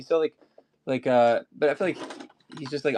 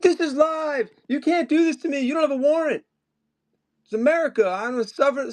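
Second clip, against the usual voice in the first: hammed-up shouting of a man being arrested; mock yelling. Pretending to be arrested, yelling at police in mock outrage. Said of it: "I was, like, yelling".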